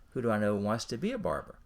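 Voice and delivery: normal talking voice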